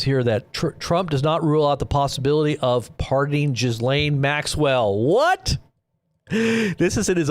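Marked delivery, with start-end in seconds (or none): 5.0-7.3 a stunned, rising what; shocked what. Real, not acted. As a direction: pitch jumps far up in disbelief. Shocked at pardon news.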